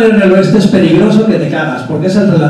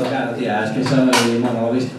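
smug aside; 8 semitones lower, 8 dB quieter than usual. Acted. Customer's smug aside to attendant.

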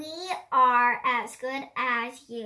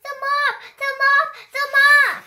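each cry louder than the last, building to a shout; rising alarm; 12 semitones higher, 12 dB louder, faster than usual. Acted. A child voicing apples spotting the mob, building to a shout. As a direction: build the warning from a call to a yell.

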